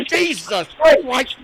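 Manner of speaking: high, loud, slow cry after falling; pained yell. Retold or imitated. Imitating her falling outside, high loud slow cry. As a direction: cry out as you hit the ground. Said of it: "hits the deck and goes".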